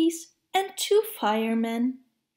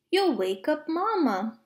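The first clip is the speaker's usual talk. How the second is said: ordinary delivery, nothing marked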